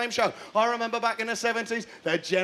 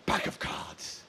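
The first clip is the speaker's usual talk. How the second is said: whispered, much softer; hushed reveal; 10 dB quieter, much slower than usual. Pretend. Comic revealing the show's concept, hushed. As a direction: drop to an underwhelmed whisper. Deflated reveal before louder restatement.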